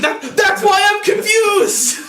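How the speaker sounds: high-pitched exasperated outburst. Real, not acted. Defending himself over the packing tape, exasperated. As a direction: burst out in confusion, pitch soaring.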